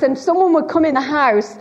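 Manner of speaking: ordinary talk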